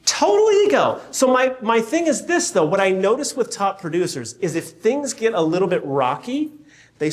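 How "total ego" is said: emphatic. Real, not acted. Emphatic agreement.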